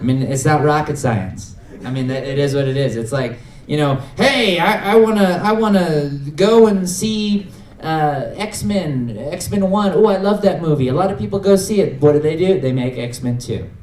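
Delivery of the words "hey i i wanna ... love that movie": high-pitched, drawn-out fan enthusiasm; eager moviegoer. Retold or imitated. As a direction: imitate an excited movie fan.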